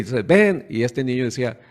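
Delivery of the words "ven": sharp loud high call of "Ven"; mother's yell. Retold or imitated. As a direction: shout for the kid across the yard. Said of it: "su mamá le gritaba".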